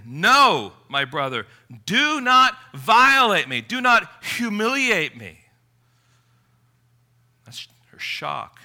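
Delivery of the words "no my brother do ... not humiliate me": louder, higher protest; No and NOT pushed out loud and high; emphatic refusal. Retold or imitated. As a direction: refuse him firmly, pushing him away. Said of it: "she says emphatically".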